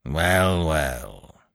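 grumbling reluctant soldier, lightly voiced; gruff. A narrator's reading. Sergeant grudgingly gives way, mildly gruff. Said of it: "said he gruffly".

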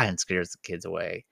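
ordinary talk, nothing marked

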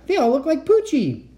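high, amazed exclamation; astonished cry. A narrator's reading. Kat seeing dogs trot into lab, astonished cry. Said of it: "I cried".

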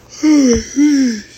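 loud high yawning sigh; big yawn. Real, not acted. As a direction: let out a big tired yawn. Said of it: "I apologize for my yawning".

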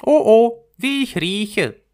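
self-admiring flower, high and loud; vain narcissus. A narrator's reading. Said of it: "sagte die Pfingstlilie".

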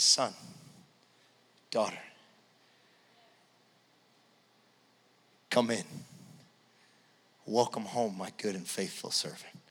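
low, soft, unhurried fatherly voice; tender welcome. Acted. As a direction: welcome a child home gently, leaving long pauses. God welcomes the believer home.

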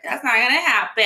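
mock imitation of a dismissive girl; mocking dumb voice. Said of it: "And her dumb voice, it was just like".

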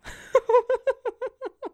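high-pitched giggle fit; high laugh. Real, not acted. Cracking up at own scrote birth joke, high laugh. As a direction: laugh helplessly, high and staccato.

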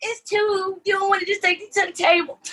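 high mocking voice of the server; mocking buffet staff. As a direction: mimic the fussy worker up high. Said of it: "So they was like".

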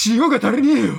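loud, high desperate demand for salt; crazed shout. Acted. Salt-crazed character begging for salt, frantic shout. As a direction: scream for salt like a madman.

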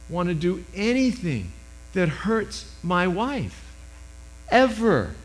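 ordinary talk, nothing marked

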